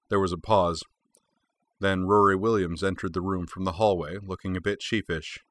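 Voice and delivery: usual speaking voice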